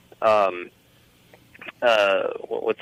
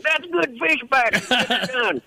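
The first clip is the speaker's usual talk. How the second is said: Larry the Cable Guy; 11 semitones higher, slower than usual. Retold or imitated. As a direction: do an exaggerated cable-guy character voice. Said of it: "and he's like".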